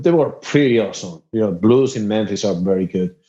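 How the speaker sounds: ordinary delivery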